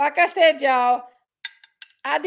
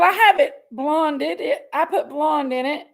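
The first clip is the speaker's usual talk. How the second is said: ordinary talk, nothing marked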